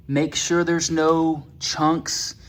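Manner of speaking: ordinary delivery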